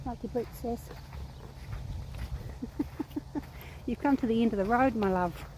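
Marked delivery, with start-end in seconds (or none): 0.9-3.8 quiet, drawn-out giggling; soft chuckle. Real, not acted. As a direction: chuckle softly to yourself. Amused by the horse.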